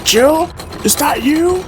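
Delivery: distant, high muffled call. Acted. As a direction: call through a thick door. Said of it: "A muffled voice called".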